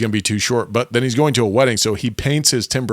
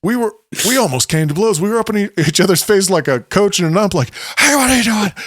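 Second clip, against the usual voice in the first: higher, heated retelling of a near fight; acting out a comic blow-up in a much higher voice; worked-up storyteller; 9 semitones higher than usual. Retelling a golf fight from Seinfeld, worked up. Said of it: "but then he says".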